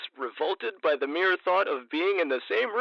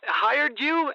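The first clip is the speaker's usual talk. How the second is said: indignant; 12 semitones higher, much slower than usual. Acted. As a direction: demand to know who hired him. Caller outraged at stranger DJ.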